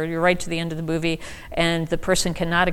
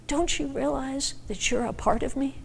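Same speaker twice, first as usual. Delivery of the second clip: softened plea; 5 semitones higher, 7 dB quieter than usual. Retold or imitated. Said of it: "she said".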